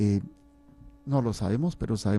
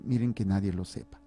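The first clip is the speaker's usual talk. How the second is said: ordinary talk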